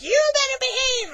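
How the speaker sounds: high, loud scolding nun voice; scolding nun warns the haunter. Retold or imitated. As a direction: wag a finger with your voice. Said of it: "She's like".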